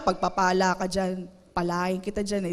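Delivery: a low, grudging mock blessing; sarcastic blessing. An annoyed spouse muttering a blessing at their partner, low and grudging. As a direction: twist the blessing into a sarcastic dig. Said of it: "Nagde-declare ka ng pagpapala".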